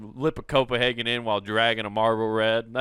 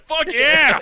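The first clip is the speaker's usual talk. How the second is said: high, loud "fuck yeah"; triumphant shout; 9 dB louder than usual. Retold or imitated. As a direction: yell it out in triumph. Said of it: "I was like".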